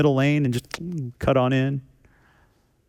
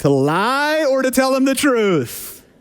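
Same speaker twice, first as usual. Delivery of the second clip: high and slow, drawn out; high comic dilemma; 10 semitones higher, slower than usual. Real, not acted. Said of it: "which was the bigger sin".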